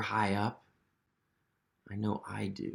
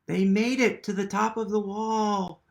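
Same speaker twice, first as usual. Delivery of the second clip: higher, louder triumphant announcement; 8 semitones higher, 6 dB louder than usual. A narrator's reading. Reader telling children Humpty reached the top, triumphant. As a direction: celebrate the arrival.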